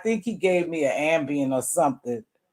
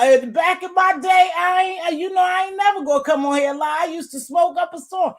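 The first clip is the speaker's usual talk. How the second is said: emphatic; 10 semitones higher than usual. Real, not acted. Emphatic confession, high loud.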